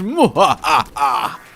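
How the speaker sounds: loud, deliberate "mwahaha"; theatrical villain laugh. Acted. Gloating over defeated Blue Baby, evil laugh. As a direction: laugh in hammed-up triumph. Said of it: "felt bad about the initial laugh".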